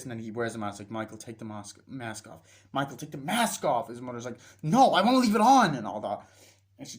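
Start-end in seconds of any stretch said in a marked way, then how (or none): 0.9-2.4 softly voiced imitation of a mother asking; understated first request; restrained request. Mother's first request, then raised repeat. 2.7-3.9 raised-voice imitation of a mother insisting; insist, leaning hard on the mask; mock parental command; firm order. Mother repeats the request more firmly. 4.6-5.8 high, very loud imitated shout; hammed-up tantrum refusal; mock defiant yell. Acted quarrel over the mask.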